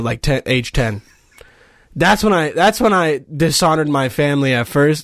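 ordinary talk, nothing marked

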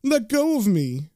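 high, pleading cry; panicked begging. Acted. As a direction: beg to be freed in acted terror. Frightened man begs to be released.

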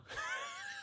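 helpless shriek of laughter; burst of laughter. Real, not acted. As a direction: crack up hard at the punchline. Punchline lands, big laugh.

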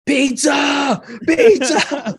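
very high-pitched mock scream; imitated dad screaming. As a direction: scream it like a panicking dad calling downhill. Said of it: "the dad is just screaming".

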